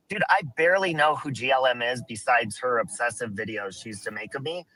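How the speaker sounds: ordinary talk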